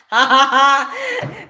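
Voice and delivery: loud, deliberate villain cackle; evil laugh. Pretend. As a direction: put on an evil "ha-ha-ha". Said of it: "The evil laugh".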